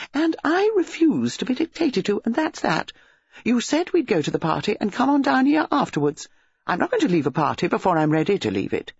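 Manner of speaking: put-on high, petulant girlfriend in a row; defiant young woman. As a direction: dig in indignantly.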